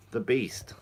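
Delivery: hushed aside about the lobster; soft whispered afterthought; playful whisper. Real, not acted. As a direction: whisper it fondly to yourself. Whispering about his pet lobster.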